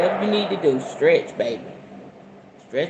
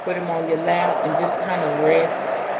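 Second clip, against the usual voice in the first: guided meditation. Acted. Guiding viewers into a calm sitting posture. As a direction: settle the listeners into their seats.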